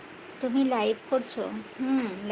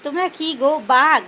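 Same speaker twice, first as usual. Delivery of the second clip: calling out; 7 dB louder than usual. Real, not acted. Calling out to a viewer.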